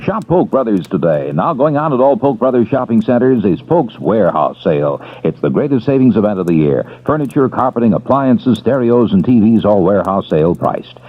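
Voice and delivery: ordinary talk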